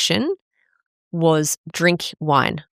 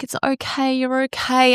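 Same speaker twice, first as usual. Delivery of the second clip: reassuring; 8 semitones higher than usual. Retold or imitated. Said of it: "And he'd be like".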